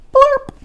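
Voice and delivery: high squeaky burp noise; tiny blurp. A narrator's reading. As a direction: make a silly little blurp.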